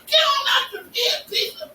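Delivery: high, angry shouted cussing; hammed-up scorned lover screaming; furious woman's shout. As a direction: yell at him to get out like a scorned woman. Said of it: "she called me all kind of words".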